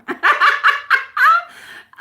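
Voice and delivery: loud, high burst of laughter; real giggle rising up. Real, not acted. Laughing at being called weird.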